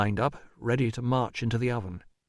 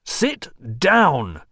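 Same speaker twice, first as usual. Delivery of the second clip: raised, forceful command; roared order; 4 semitones higher, 4 dB louder than usual. A narrator's reading. Visitor cutting off the shrieking woman, roaring. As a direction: roar it to overpower her. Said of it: "roared Rousseau".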